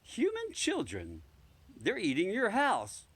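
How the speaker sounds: high, slow cat voice; drawn out and high like a talking cat; cat Selina reporting calmly. Acted.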